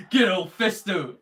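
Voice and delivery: chuckling fondly, slowed down; laughing. Real, not acted. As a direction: laugh warmly through it. Laughs about Fisto.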